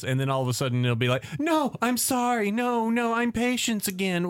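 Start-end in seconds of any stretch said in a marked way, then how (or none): 1.4-4.3 high-pitched meek apologetic act; split personality. Retold or imitated. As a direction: flip into a high, pleading alter ego. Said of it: "having this split personality thing".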